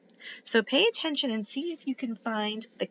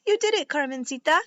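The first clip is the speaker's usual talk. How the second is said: excited praise; 5 semitones higher, 3 dB louder, faster than usual. A narrator's reading. Brother celebrating sister's success.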